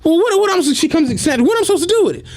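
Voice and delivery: high, flustered lady's question; mock confused lady. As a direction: play the lady as baffled.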